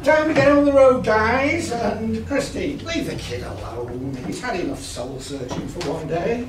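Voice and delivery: hearty laugh, then calmer; jovial leader voice. Acted.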